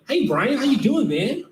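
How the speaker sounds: high, overly chummy supervisor voice; fake-friendly supervisor. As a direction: play the boss who's suddenly your buddy. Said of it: "He go".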